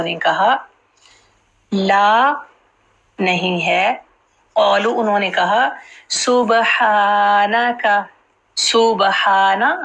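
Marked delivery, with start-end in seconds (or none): 6.1-9.8 सुबहानका and सुबहाना drawn out syllable by syllable; सुबहानका said slowly with held vowels; slow model pronunciation. Shown as a demonstration. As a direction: stretch the long "haa" in सुबहाना. Teacher models the verse word.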